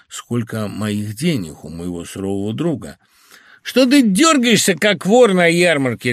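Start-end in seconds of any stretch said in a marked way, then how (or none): 3.6-6.1 loud, high-pitched bark. A narrator's reading. Gruff friend rebuking a nervous host, barking. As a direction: snap at him loudly. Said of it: "рявкнул он".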